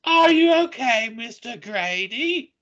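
very high, shrill British voice; fearful high call in a British accent. Acted. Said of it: "When did we become British?".

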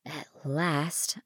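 usual speaking voice

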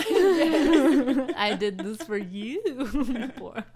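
laughing through the words; drawled out between laughs; laughing mock quote. Mock bartender pour line.

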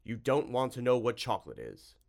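deadly earnest bluff; serious sincerity. A narrator's reading. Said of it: "the clear sincerity in which it was spoken".

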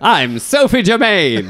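lightly voiced flapper actress; a hint of an old-time starlet; gangster's moll. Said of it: "sounds like a flapper, like an actress back in the day".

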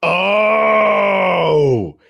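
high, loud exaggerated oh; mock surprise. Real, not acted. Cohost gasps at the Death reveal in exaggerated surprise. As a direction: overplay the sudden realization.

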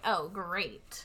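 low, soft, unimpressed deadpan; dry mock enthusiasm; sarcastic mom. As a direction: drop it down like a fed-up mom. Mom's reply to bus suggestion.

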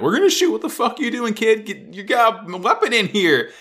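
high, urgent shouting of armed guards; alarmed soldiers' challenge. Acted. Soldiers challenging intruder at gunpoint.

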